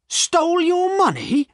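very high, indignant outburst; angry cry. A narrator's reading. Poacher answering Silas's accusation, angry cry. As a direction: throw the accusation back, outraged. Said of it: "cried Jem angrily".

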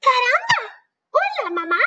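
a newborn chick greeting its mama; baby chick. Acted. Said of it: "looked up at Jack and said".

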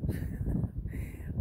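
a little laugh to himself. Real, not acted. Amused at goofy dome.

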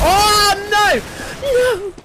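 high, loud wail of defeat; dismayed yell. Real, not acted. Gamer killed with his own Negev, dismayed yell. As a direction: cry out as it all goes wrong.

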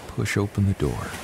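usual speaking voice

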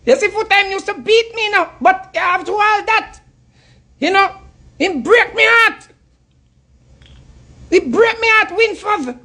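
pleading falsetto mock of a wounded lover; mock Aisha. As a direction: squeak it out like a lovesick woman moaning to her man. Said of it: "You ever hear Aisha out about".

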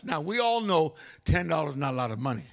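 ordinary delivery

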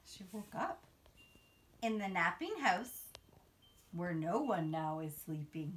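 put-on sing-song storybook read-aloud for little kids; reading to children. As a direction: read it like a bedtime picture book, rising on each new animal. Reads picture book to children.

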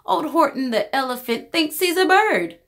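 mild mocking taunt; taunting yell. A narrator's reading. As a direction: tease him from below. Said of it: "they yelled".

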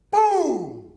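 loud, high boom falling in pitch; booming explosion imitation. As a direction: burst out like a blowing tyre.

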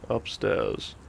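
plain reading voice

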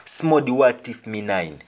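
Jamaican patois line, said twice; patois example for learners. Shown as a demonstration. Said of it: "Somebody wants to steal my pistol".

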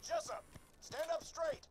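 yelled order across town; shouting. Acted. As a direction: call out loud and high. Calling out to Jessup.

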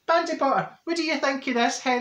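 high sing-song voice for a wee one; asking the toddler in a high sing-song voice; talking to a toddler. Retold or imitated. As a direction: coo up high at the two-year-old.

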